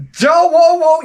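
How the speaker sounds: hammed-up startled cry; startled whoa. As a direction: jump back with a high whoa. Startled on seeing crab.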